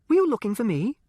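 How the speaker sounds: put-on high, sweet well-bred girl's voice; innocent girl. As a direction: feign innocence to her mother, pitched high. Livy innocently greets mother.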